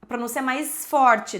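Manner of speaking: ordinary talk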